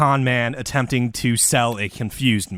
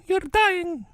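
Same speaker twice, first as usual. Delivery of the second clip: weepy visitor voice. Retold or imitated. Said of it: "he's like".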